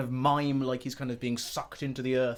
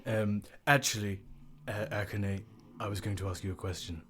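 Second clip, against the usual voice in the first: smooth low voice; 6 semitones lower, 8 dB quieter than usual. Acted. Smooth voice for Joe again.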